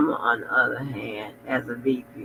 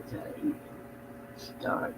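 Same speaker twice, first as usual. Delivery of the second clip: whispering; 16 dB quieter than usual. Real, not acted. Sleepy aside while eating.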